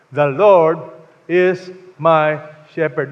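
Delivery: The Lord is my shepherd, slow and weighty; slow emphatic reading; emphatic scripture reading. Shown as a demonstration. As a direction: read it out loud and slow, leaning on Lord. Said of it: "let's read this together".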